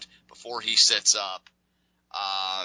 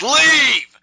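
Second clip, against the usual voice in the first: one sudden furious blast; explosive shout; 15 dB louder than usual. Retold or imitated. Kane roaring at Bryan to get out. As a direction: bellow it as loud as you can, up high. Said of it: "Kane just explodes".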